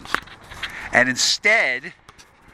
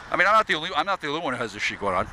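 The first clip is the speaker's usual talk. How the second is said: normal talking voice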